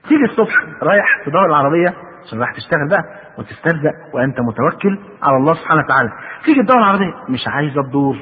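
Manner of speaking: ordinary talk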